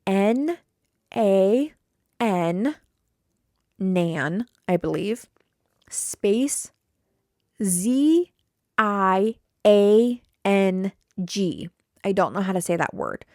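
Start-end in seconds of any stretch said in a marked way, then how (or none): 0.1-11.7 letters said one by one, slow; spelling a restaurant name so she won't botch it, slowly; spelled out. Shown as a demonstration. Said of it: "I'm gonna spell the name".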